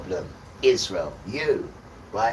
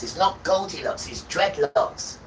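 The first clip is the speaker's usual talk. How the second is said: "dreadlocks" said slowly and pointedly, higher; corrective stress; 5 semitones higher, 3 dB louder, slower than usual. Real, not acted. Correcting the listener's word, slow stressed "dreadlocks". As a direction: stretch out "dreadlocks" to correct him.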